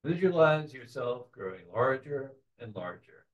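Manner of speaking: soft, unhurried meditation voice with long pauses; softly led visualization, phrase by phrase; guided meditation. Real, not acted. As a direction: gently guide the group into a relaxed trance.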